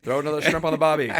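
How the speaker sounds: loud, fast hammed-up Aussie voice; Australian accent. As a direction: ham up a cartoon Australian. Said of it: "Australian accent".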